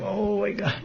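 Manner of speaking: high, soft acted exclamation; straining to reach. Radharani reaching for a flower, high soft straining voice. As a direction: play her stretching up, high and small.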